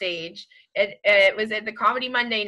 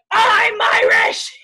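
slow, higher, louder furious line; angry Irish guy; 9 semitones higher, 6 dB louder, much slower than usual. Retold or imitated. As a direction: spit it out at the comic, slow and loud. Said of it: "And he's like".

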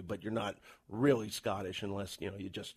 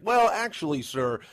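high, polite mock Canadian accent; Canadian accent, starting high and loud; 6 semitones higher, 6 dB louder, slower than usual. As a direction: exaggerate a courteous Canadian accent. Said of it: "broke out into my Canadian accent".

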